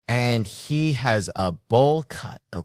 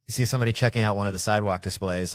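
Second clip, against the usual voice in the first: usual speaking voice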